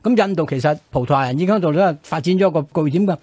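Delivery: normal talking voice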